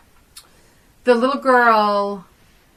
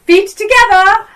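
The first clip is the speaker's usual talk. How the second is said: demanding mother; 9 semitones higher, 5 dB louder than usual. Retold or imitated. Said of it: "And she's like".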